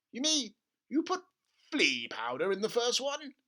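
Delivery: high and incredulous; can hardly believe it; a stunned dad, high; astonished father. Acted.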